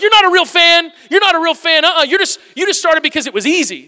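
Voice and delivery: high, loud gatekeeping scoff; mock-indignant superfan, loud and high; snobby fan. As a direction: sneer at the latecomer fans. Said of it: "you know, you're like".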